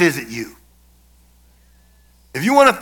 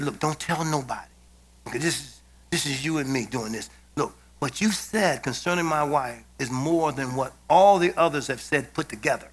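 much softer, low confidential voice; hushed secret aside; 7 semitones lower, 10 dB quieter than usual. Retold or imitated. Slipping the orderly a hundred dollars, hushed and confidential. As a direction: keep it between the two of you, hushed.

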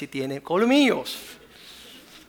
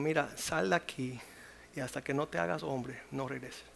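quiet stern dismissal; 2 semitones lower, 10 dB quieter than usual. Retold or imitated. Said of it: "Y le dijimos".

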